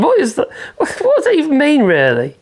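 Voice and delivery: incredulous question with a laugh through it; laughing disbelief. Real, not acted. Vlogger laughing at a reader's odd phrase, amused disbelief.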